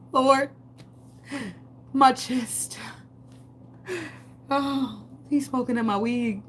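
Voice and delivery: high, gasping mock chest pain; mock distress. Woman playing shocked over her weed being smoked, mock distress. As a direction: gasp it out high, hamming up the shock.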